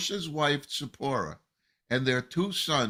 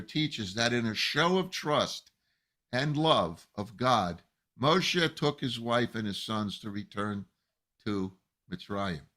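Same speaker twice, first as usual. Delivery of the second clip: ordinary talk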